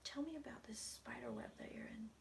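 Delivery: soft unvoiced coaxing whisper; whispering to spirit. Real, not acted. Psychic whispers a question to the spirit. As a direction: coax him gently in a whisper.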